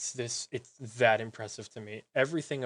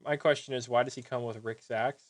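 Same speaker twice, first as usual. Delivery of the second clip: ordinary talk, nothing marked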